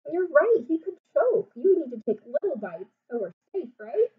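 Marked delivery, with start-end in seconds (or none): none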